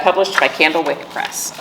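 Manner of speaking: ordinary delivery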